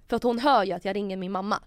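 ordinary talk, nothing marked

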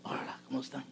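whispered hello to the family; hushed hello as you walk in the door; soft whisper. Retold or imitated. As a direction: whisper the greeting gently. Greeting family on coming home.

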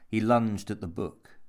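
ordinary delivery, nothing marked

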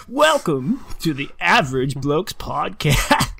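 slow, drawn-out radio host intro; nineties radio voice. Acted. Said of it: "stick with the nineties radio".

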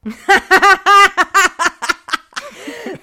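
big real laugh, high and loud; delighted loud laugh; loud burst of laughter. Real, not acted. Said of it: "That's so good".

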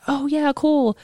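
lightly voiced students; impressed kids. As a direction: play the kids, mildly impressed. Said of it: "They're like".

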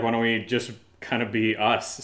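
ordinary talk, nothing marked

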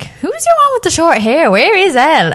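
high-pitched puzzled questions; retold bafflement. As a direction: act out your earlier confusion, pitched well up. Retelling their own puzzled reaction.

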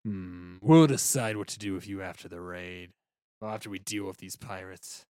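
low, restrained officer voice; low-pitched, deliberate naval commander; vice admiral's voice. Acted. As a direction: deliver it deep and measured. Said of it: "that was not his voice".

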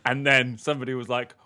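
ordinary talk

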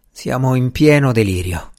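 ordinary delivery

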